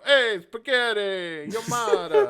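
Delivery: slow and loud, high cartoon Italian accent; mock Italian accent. Showing off the only Italian he knows in a hammed-up cartoon Italian accent. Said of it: "this is the Italian I know".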